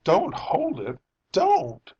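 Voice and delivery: high, loud frightened plea; alarmed plea. A narrator's reading. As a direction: beg him urgently, pitch leaping at the end. Wife pleads with husband, alarmed.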